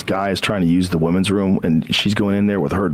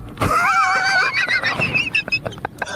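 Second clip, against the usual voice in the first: high laugh. Real, not acted. Laughs at own joke.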